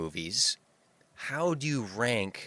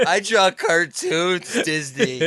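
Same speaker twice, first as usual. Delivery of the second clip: higher, louder, hammed-up eager reply; mock eager artist; 6 semitones higher, 5 dB louder than usual. Speaker imagines the artist happily agreeing to Disney, hammed-up eager voice. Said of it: "in my head, I'm going".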